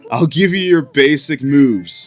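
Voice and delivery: surfer dude voice. Acted. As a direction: drawl it like a chill surfer. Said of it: "I made Bottles just have, like, a surfer dude voice".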